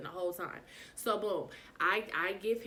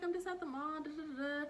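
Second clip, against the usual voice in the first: high retold reply; 8 semitones higher, 5 dB quieter than usual. Retelling her own reply.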